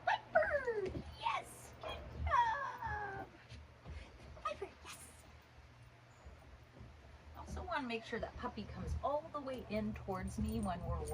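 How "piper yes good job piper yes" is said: high, slow call and praise; squeaky praising a dog. Real, not acted. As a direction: sing out the dog's name. Calling and praising puppy.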